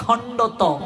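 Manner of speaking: খণ্ডত with o in the middle, carefully parted; corrected pronunciation. Shown as a demonstration. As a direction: repeat খণ্ডত as a careful model. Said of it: "যেমন আমরা বলি খণ্ডাত্ম".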